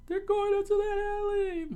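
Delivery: loud, very high falsetto cry; townsperson calling out. A narrator's reading. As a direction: alert the mob in a squealing voice. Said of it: "one of the townspeople pointed out".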